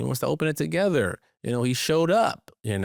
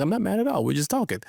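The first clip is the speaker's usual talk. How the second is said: higher-pitched mock defensive friend; retold protest; 8 semitones higher, faster than usual. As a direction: protest that nothing is wrong, pitched up. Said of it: "Why you so mad".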